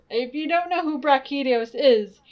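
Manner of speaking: ordinary delivery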